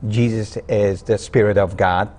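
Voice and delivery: usual speaking voice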